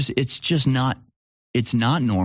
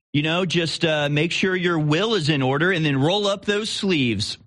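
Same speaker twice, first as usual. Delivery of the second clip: much higher, bouncy mock-cheerful ad voice; mock public health announcer; 5 semitones higher than usual. Telling viewers to get shots, sarcastic cheery announcer. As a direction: sell the flu shot like a cheery ad.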